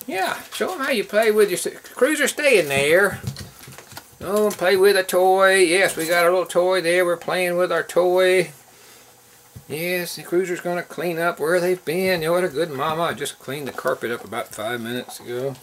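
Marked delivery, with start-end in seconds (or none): 0.1-3.2 lifted, high pet-calling voice; high-pitched coaxing. Real, not acted. Owner calls Cruiser and puppies to play, coaxing high. 4.2-11.8 playful pet talk. Real, not acted. Owner cheers puppies playing with toy, high playful pet talk.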